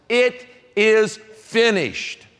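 slow, drawn-out and hushed with weight; solemn dying words. Acted. Pastor voicing Jesus on the cross, slow and solemn. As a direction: let each word fall slowly, like a final breath.

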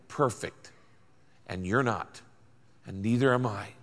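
dropped low and soft; quiet. Acted. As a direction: let it fall quietly to the listeners. Quiet emphatic aside.

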